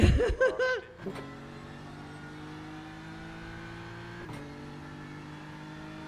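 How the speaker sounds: long amused laughter; hearty laugh. Real, not acted. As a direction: burst out laughing at the crash. Laughing at rival's crash.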